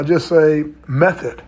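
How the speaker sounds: each syllable stretched slowly; slow drawn-out speech. Shown as a demonstration. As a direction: draw out method, very slowly. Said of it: "if I speak like that".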